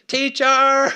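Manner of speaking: high, very loud holler down the hall; full-voiced, hammed-up call; comedic yell. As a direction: imitate a little boy bellowing for his mom. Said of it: "he'll yell down the hall to his mom".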